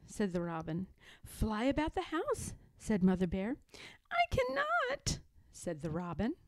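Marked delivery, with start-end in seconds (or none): 1.2-2.5 higher, soft, rising suggestion; coaxing Mother Bear. A narrator's reading. Mother Bear urging sad robin, softly coaxing. 4.1-5.2 very high, soft bird voice; sad little robin. A narrator's reading. Robin refusing Mother Bear, small and sad.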